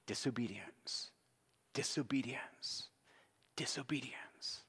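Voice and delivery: hushed, spaced-out whisper; whispered refrain. Real, not acted. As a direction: whisper it gravely, pausing between each. Preacher repeats the key word hushed.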